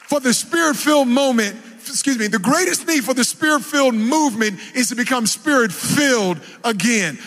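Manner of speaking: ordinary talk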